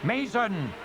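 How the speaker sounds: high ringing cry; battle cry. Acted. The escort crying Mason as they charge. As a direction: shout the rallying name. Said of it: "Elora heard".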